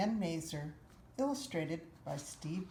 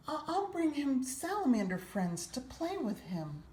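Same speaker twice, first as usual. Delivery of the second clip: put-on eager little boy; 9 semitones higher than usual. A narrator's reading. Boy tells his mother his plans, eager higher child voice.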